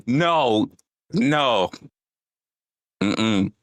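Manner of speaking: ordinary delivery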